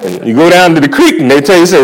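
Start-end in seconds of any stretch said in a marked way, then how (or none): none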